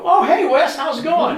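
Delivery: high, loud, laughing hello; mock cheery greeting. To the shot caller, a mock cheery laughing hello. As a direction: greet him with bright fake friendliness. Fake friendly greeting to rival.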